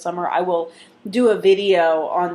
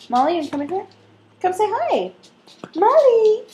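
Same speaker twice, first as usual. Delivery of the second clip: calling the dog; 13 semitones higher than usual. Real, not acted.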